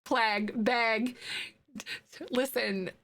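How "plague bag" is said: "Plague, bag" drawn out slowly with the same flat Midwestern a; Midwestern vowels. Shown as a demonstration. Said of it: "I should say bag next to".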